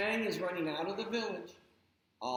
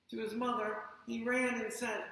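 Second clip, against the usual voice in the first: ordinary delivery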